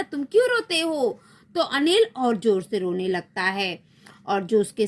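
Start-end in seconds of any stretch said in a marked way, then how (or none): 0.0-1.2 mild scolding. Acted. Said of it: "जब रात रानी उसे डांटती है".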